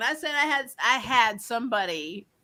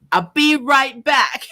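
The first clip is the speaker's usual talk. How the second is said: slow, louder, higher and overly careful; deliberate retold reply; 5 semitones higher, 6 dB louder, much slower than usual. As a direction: draw out each word as the associate. Quoting the store associate.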